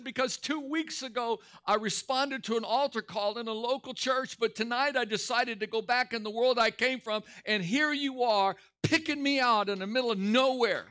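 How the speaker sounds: high-pitched, worked-up mock crying; imitated tearful confession. Preacher voicing the man explaining why he cries, tearful. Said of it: "he busted out crying on the sidewalk".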